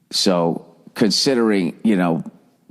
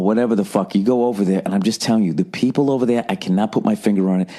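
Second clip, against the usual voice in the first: ordinary delivery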